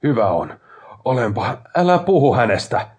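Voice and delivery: loud, high-pitched angry warning; angry outburst. A narrator's reading. Grieving brother forbids talk of his dead fiancée, angry outburst. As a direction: burst out, building to a near shout.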